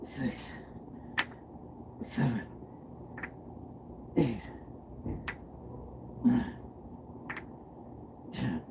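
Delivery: very quiet, effortful rep count; counting through exertion. Real, not acted. Streamer counting out a workout set, softly through exertion. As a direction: count reps under your breath mid-set.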